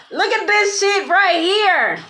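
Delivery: high, drawn-out disbelief; slow high-pitched excited emphasis; amazed exclamation. Real, not acted. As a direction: stretch it out high, marvelling at the find. Exclaiming at the card she sees.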